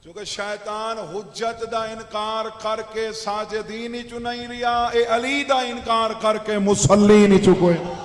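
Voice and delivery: loud oratory swelling to a huge shout; full-force preaching climax. Real, not acted. Preacher likening deniers of Ali to Satan, building to a shouted climax. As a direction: build steadily to a roaring finish.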